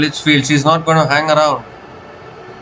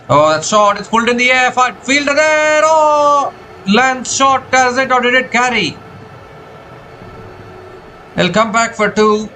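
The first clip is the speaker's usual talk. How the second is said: rising excited call, much higher; cricket commentator; 7 semitones higher than usual. Real, not acted. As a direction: call the catch as it hangs. Ball in the air, live call.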